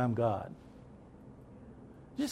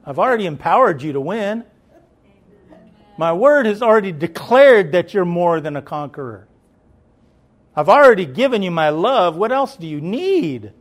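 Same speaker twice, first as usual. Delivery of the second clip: voicing God; 2 semitones higher, 7 dB louder than usual. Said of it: "God's like".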